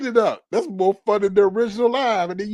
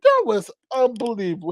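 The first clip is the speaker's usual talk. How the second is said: ordinary talk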